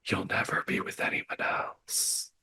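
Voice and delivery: very soft, fully whispered possessive threat; ghostly whisper. Acted. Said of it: "like, whispering".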